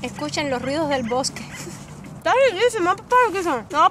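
talking between bites; chewing while talking. Real, not acted. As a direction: mouth partly full. Seen 0: holds corn bread, hand at mouth, seems chewing while talking.

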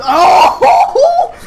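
gleeful whoop; delighted laughing shout. Real, not acted. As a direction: cackle with glee. New weapon wrecks the turret.

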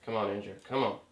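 soft, tense self-encouragement; quiet self-urging. Real, not acted. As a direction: coax yourself under your breath while concentrating. Urging himself during the attempt.

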